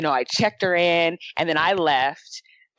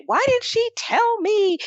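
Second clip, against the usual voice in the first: high, drawn-out indignant whine; exaggerated squeal of complaint; mock upset; slower than usual. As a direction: imitate an upset customer, voice shooting way up. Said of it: "the lady was actually upset".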